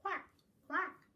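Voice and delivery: high, loud short quacks; two playful high quacks; duck quack. Acted. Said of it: "called the duck".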